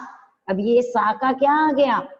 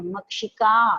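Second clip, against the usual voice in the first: ordinary delivery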